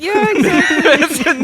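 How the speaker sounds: slow, high laughing agreement; laughing yeah. Real, not acted. Agreeing with the spicy-biscuit joke, laughing. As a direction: squeal it out laughing. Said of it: "laughing agreement".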